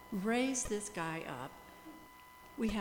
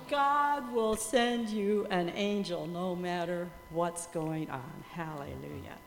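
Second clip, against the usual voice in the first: slow, louder testimony line; preaching emphasis; 4 dB louder, slower than usual. Acted. Missionary to the congregation, slow preaching emphasis. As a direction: drive the lesson home slowly.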